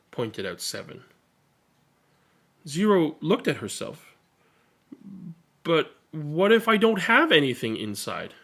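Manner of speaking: put-on lively read-aloud for children; expressive picture-book reading; story narration. A narrator's reading. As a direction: read it to a room of kids, bright and animated.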